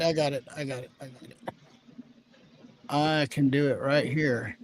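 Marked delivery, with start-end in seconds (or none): 0.0-1.4 trailing off, quieter each time; drifting away from the mic; fading away. Real, not acted. Muttering while leaving the mic.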